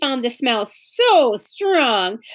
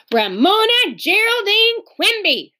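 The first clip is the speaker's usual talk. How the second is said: angry; 10 semitones higher, 4 dB louder than usual. A narrator's reading. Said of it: "said Beezus angrily".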